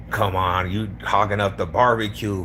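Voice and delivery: ordinary talk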